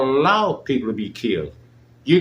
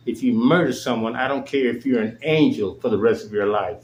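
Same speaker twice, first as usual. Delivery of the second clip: ordinary delivery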